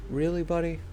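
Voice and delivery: high-pitched questioning of the dog; talking up high to a dog; doggy voice. Real, not acted. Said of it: "talk in the doggy voice".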